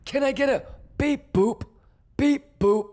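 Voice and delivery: high, loud hype yelling; hype shouting. Real, not acted. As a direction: hype the chat up hard. Market open hype.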